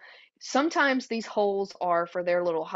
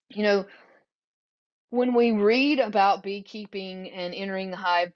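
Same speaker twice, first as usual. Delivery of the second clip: ordinary talk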